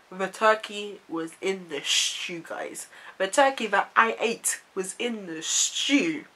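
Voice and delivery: ordinary delivery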